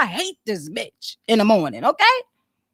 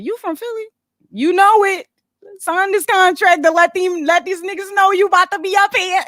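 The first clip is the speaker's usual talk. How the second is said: imitating interviewers; 6 semitones higher than usual. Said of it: "everybody said the same thing".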